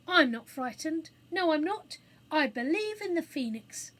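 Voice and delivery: high, loud insistence; defiant bravado. A narrator's reading. Robert declaring faith amid the fire, defiant bravado. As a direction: insist on courage against the fear.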